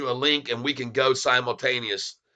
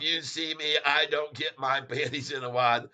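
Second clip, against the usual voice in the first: usual speaking voice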